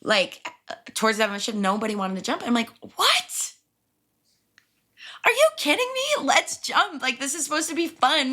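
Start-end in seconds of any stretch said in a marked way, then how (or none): 3.0-8.3 high-pitched, incredulous protest; indignant disbelief. Retold or imitated. To coworkers backing out of the jump, incredulous. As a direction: start on a sky-high "what", then plead.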